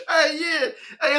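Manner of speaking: ordinary delivery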